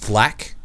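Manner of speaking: flak said like flak armor; pronounced flak. Shown as a demonstration. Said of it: "not a hundred percent sure on the pronunciation".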